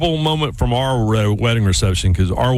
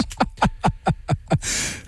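breathy laugh; 6 semitones higher, 6 dB quieter than usual. Real, not acted. Laugh after retold outburst.

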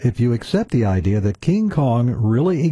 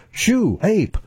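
high-pitched shooing, no real shout; mock yell; 11 semitones higher than usual. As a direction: shoo it off with a swooping high call. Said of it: "So I yelled at his feet".

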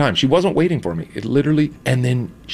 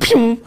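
mock pew; 10 semitones higher, 4 dB louder than usual. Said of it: "I just was like".

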